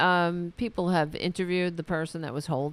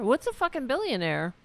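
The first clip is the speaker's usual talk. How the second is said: ordinary delivery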